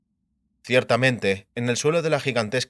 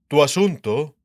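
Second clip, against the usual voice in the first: put-on old king; 3 semitones higher, 5 dB louder, slower than usual. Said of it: "dijo Esterad".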